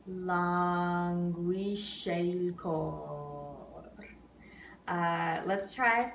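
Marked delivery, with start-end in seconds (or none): 0.1-3.9 "languisce il cor" with "sce il" merged into shale; elided, very slow. Shown as a demonstration. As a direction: stretch it slowly, joining sce and il. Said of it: "So it's going to sound like".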